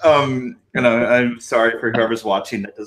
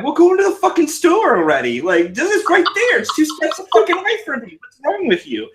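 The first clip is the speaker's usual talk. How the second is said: exasperated parent. Retold or imitated. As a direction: yell at the kids in mock frustration. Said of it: "And I'm like".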